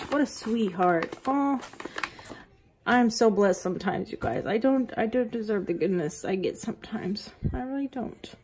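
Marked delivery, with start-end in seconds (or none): 4.5-8.3 choked-up whisper; teary whisper. Real, not acted. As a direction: whisper it, close to tears. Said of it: "I'm a little taken aback".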